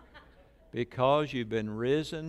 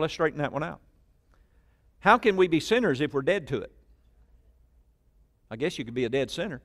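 usual speaking voice

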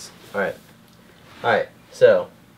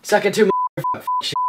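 high, loud nonsense yell; wild yell; 5 dB louder, much faster than usual. Acted. End title screen, shrieked nonsense catchphrase, high and loud. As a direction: shriek it out wildly. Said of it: "where I go".

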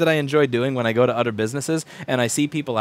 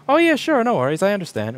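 high, bright mock-chipper customer voice; sing-song fake cheerful reply; gracious customer; 6 semitones higher than usual. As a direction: play the overly easygoing customer. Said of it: "do they go".

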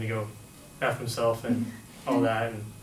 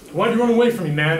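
higher, louder aggressive demand; retold angry challenge; 6 semitones higher, 7 dB louder than usual. Guy follows storyteller across the street, angry yell. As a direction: imitate a guy yelling after someone.

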